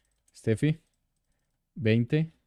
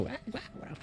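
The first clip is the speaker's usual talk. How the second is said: mock dog barks; 7 semitones higher, 19 dB quieter than usual. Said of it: "van a poner la alerta".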